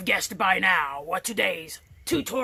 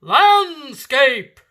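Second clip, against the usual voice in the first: loud quiz answer; 5 semitones higher, 8 dB louder than usual. Acted. Answers own quiz loudly.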